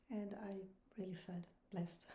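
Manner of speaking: normal talking voice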